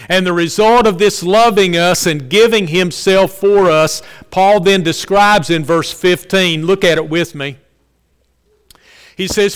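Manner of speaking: loud, ringing pulpit delivery; preaching at full force. Acted. Preacher proclaiming Paul's words to the congregation, loud and fervent. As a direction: preach it out to the whole church.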